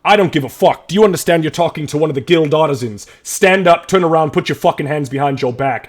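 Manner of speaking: loud, high furious orders; shouting guard. Acted. City guard arrests Gray, furious shouting orders. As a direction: bark arrest orders at him.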